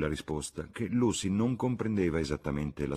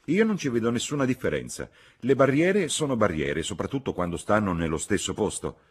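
raised, higher voice of protest; crying out; 6 semitones higher, 6 dB louder than usual. A narrator's reading. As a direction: object loudly, easing toward the end. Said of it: "gridò la signora Honeychurch".

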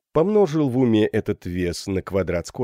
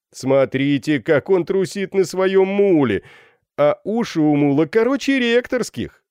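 high, loud mocking hails; jeering students; 11 semitones higher, 5 dB louder than usual. A narrator's reading. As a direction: heckle a dignitary from the window. (насмешливые выкрики школяров)

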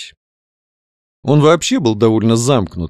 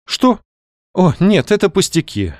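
higher, lightly voiced absent-minded woman; 8 semitones higher than usual. A narrator's reading. Каролина brushes off the doctor's question, absent-minded. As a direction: answer distractedly, voice lifted. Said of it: "рассеянно проговорила Каролина".

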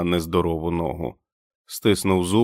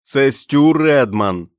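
loud, slow call into the dark; shouting; 6 semitones higher, 6 dB louder, slower than usual. A narrator's reading. Stu shouts his name to the hidden sentry, loud and slow. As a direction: call your name out across the snow. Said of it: "крикнув він у темряву".

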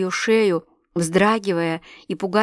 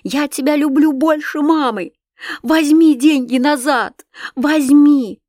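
high, loud, slow pleading; desperate begging; 6 semitones higher, 5 dB louder, slower than usual. A narrator's reading. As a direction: beg him with all your strength. Girl chases father, begging.

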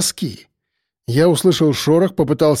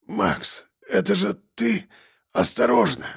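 weak hoarse warning, soft and higher; 6 semitones higher, 6 dB quieter than usual. A narrator's reading. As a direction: rasp it out, barely voiced. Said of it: "прохрипел он".

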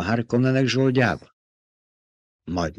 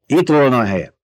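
louder, higher, sharp reply; snapped retort; 4 semitones higher, 5 dB louder than usual. A narrator's reading. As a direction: snap back at the guard. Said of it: "csattant fel Jessica". (élesen felcsattanva)

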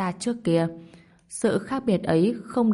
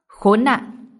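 louder, sharp exclaimed curse; cursing; 3 semitones higher, 6 dB louder than usual. A narrator's reading. Villain hearing of the hero's second fire, cursing. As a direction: swear in angry dismay. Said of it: "thì thầm chửi thề".

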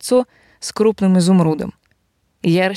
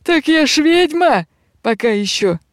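proud witch; 8 semitones higher than usual. Acted. Witch boasts back.

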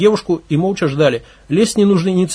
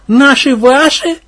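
skeptical mocking echo, pitched up; 8 semitones higher, 6 dB louder, much slower than usual. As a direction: doubt every word. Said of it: "скептически".

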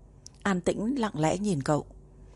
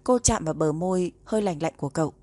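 usual speaking voice

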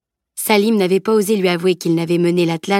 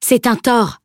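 firm verdict; 5 semitones higher, 4 dB louder, slower than usual. Acted. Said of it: "asséna Maître Douhomme, intraitable".

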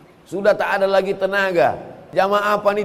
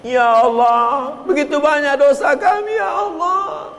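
tearful prayer; 10 semitones higher than usual. Retold or imitated. Prayer leader crying over the people's sins, mock tearful. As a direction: plead like a leader near tears. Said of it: "Menangis yang bimbing".

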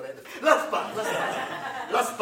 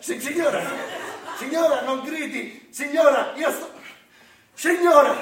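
louder and higher over the noise; shouting back; 6 semitones higher, 8 dB louder than usual. Acted. Santa shouting back at a yelling mother, loud and high. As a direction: yell to be heard.

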